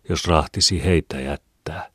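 ordinary delivery

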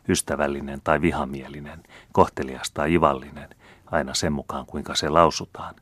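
ordinary delivery, nothing marked

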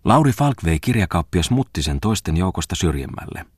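ordinary delivery, nothing marked

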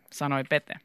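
ordinary delivery

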